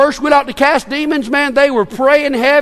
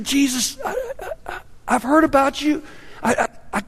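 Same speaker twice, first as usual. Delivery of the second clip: soft, halting stammer; acted timid plea, softly stumbling; hesitant pleading; 3 semitones higher, 7 dB quieter than usual. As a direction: falter timidly, barely daring to speak up. Desperate father stammering to Jesus.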